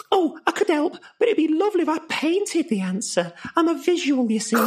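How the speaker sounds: high, floaty student voice; a dreamy girl with her eyes still closed. Acted. Visual learner student voiced.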